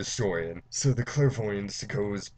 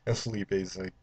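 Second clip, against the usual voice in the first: ordinary talk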